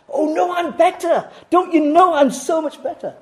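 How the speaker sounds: high, put-on voice of a smug boaster; mock smug voice. Preacher mocking a self-righteous listener, high and smug. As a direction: play the self-righteous one. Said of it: "You're just the same in God's sight".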